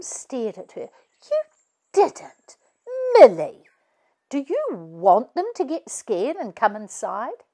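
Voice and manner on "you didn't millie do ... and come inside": mum's shocked reproach, high then firm; dismayed scolding. A narrator's reading. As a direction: tell her off in disbelief. Mum scolds Millie.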